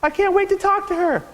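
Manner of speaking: high, gushing excitement; breathless delight about a phone call; thrilled squeal. Retold or imitated. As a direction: squeal it like a giddy fan. Retelling his own thrilled reaction.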